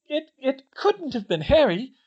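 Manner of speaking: high, loud, halting protest; stammering. A narrator's reading. Hermione defending Harry to Katie, stammering. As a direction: stumble on the first word, distressed. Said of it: "Hermione stammered".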